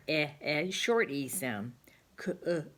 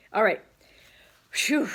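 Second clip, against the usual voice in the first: much louder, higher cheer; loud all right; 5 semitones higher, 8 dB louder than usual. Real, not acted. Loud relieved exclamation.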